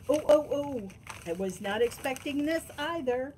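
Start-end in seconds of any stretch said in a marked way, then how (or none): none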